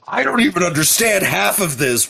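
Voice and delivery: high, baffled lawyer muttering to himself. Retold or imitated. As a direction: play a lawyer lost in legal jargon. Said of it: "being the lawyer that had to write up those legal documents".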